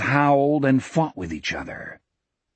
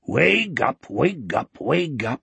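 responding chorus. Acted. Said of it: "a second chorus responded".

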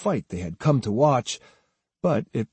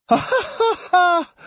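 high staccato ha-ha laugh; mocking laugh; 3 dB louder than usual. A narrator's reading. Swordsman laughing at his accusers, high mocking laugh. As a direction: laugh in their faces.

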